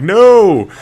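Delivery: sharp, loud, high no; loud mock-stern no; firm no. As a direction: stop her in her tracks. Dad stopping excited daughter.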